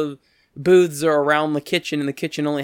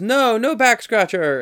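imitated cook's high, slow refusal; higher, drawn-out denial; kitchen lady; 7 semitones higher, 4 dB louder, slower than usual. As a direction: play along as the kitchen lady. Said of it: "And then the lady goes".

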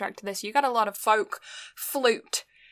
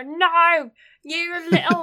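loud, higher mock-angry mum; impression of mum; 7 semitones higher, 6 dB louder, slower than usual. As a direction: yell like a scolding mum. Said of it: "That's my impression of my mum".

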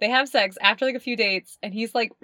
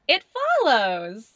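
really excited; 3 dB louder, slower than usual. Real, not acted. Host announcing today's horror movie, really excitedly. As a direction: announce the title with excited relish. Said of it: "I said that really excitedly".

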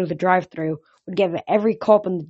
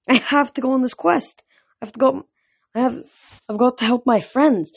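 higher-pitched insistent plea; urgent insistence; 6 semitones higher than usual. A narrator's reading. Boy telling the god he must help friends, insistent. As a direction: press the point, urgently.

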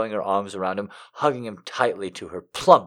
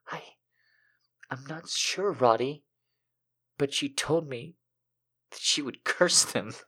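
soft, higher, halting confession; hesitant damsel; 5 semitones higher, 8 dB quieter than usual. A narrator's reading. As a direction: falter softly between pauses.

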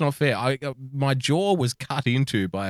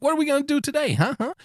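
high, bouncy puppy voice, much higher pitch; eager cartoon puppy; 14 semitones higher, faster than usual. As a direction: yap it out like an excited little cartoon dog. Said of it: "that little puppy that dances around the big dog in that cartoon".

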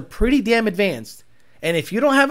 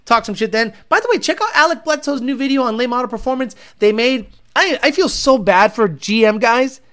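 ordinary delivery

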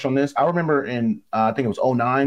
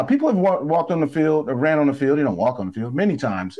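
normal talking voice

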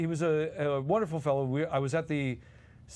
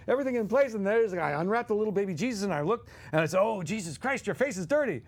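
Dom DeLuise voice; 5 semitones higher than usual. Retold or imitated. Dom telling his Christmas story on air, jolly and high. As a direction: gush like an excited showman. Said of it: "Dom DeLuise goes off".

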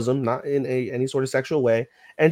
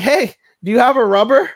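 high, loud call from the bath; 10 semitones higher, 10 dB louder than usual. Retold or imitated. Yamada calling from the bath, loud high call. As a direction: shout it through the door.

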